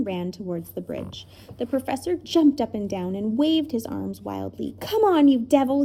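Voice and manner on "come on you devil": raised, loud challenge; calling out. A narrator's reading. Professor to the giant bird, calling out defiantly. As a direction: taunt the beast across the distance. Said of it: "he called out".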